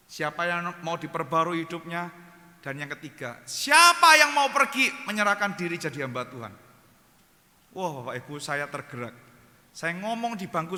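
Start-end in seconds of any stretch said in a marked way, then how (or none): 3.5-6.5 loud, raised altar call; preacher's challenge. Retold or imitated. Retelling Pak Tom challenging the revival crowd, loud and rousing. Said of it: "Pak Tom tantang K K R ini".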